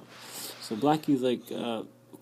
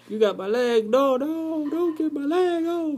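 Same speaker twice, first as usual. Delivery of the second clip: much higher, loud squeal of mock fear; hammed-up squealing spider; panicking spider; 7 dB louder than usual. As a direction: plead as your leg is caught. Spider caught by leg.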